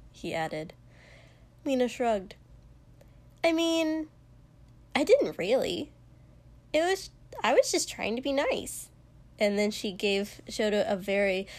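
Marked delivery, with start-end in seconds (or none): 3.4-8.9 high-pitched, shrugging backpedal; Mina's offhand excuse. A narrator's reading. Friend takes back her offer, high and offhand.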